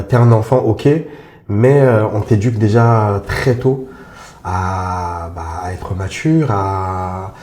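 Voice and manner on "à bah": ordinary talk, nothing marked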